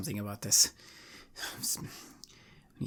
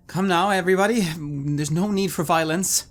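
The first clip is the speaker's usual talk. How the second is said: unconvincingly; 7 semitones higher, 8 dB louder than usual. Acted. Bard tries to stop the fight, unconvincingly. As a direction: try to calm them without believing it. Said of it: "I say unconvincingly".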